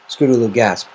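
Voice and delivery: usual speaking voice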